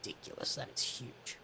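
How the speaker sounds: whisper, voice gone; whispered aside. Retold or imitated. Telling cohost his reaction, mock stunned whisper. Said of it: "I was like".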